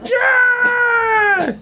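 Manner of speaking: very high, loud slow screams. Real, not acted. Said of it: "I get to scream like a little kid again".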